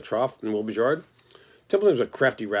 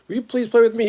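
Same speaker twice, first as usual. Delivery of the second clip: much higher, eager begging little pig; pleading pig voice; 11 semitones higher, 5 dB louder than usual. Acted. The pig begs the lamb to play, high and eager. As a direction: beg sweetly, voice way up.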